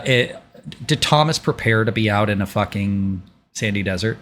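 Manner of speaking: ordinary delivery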